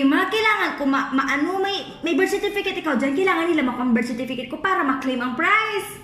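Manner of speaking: usual speaking voice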